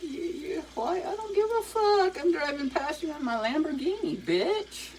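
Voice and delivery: high, cocky mock rapper brag; hammed-up high rapper swagger; rapper impression. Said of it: "those rappers, they're like".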